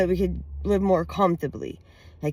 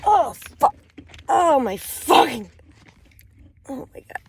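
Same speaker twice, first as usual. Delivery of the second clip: finger stuck in pain; 7 semitones higher, 4 dB louder than usual. Real, not acted. Finger caught in the device.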